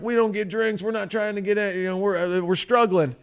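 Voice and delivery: high-pitched mock grumbling; complaining. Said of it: "So they're complaining".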